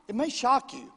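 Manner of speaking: ordinary talk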